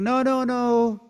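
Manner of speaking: high, loud no no no; refusing shout. Acted. Shiva refuses Durvasa outright, high loud refusal. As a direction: turn him away with a cry.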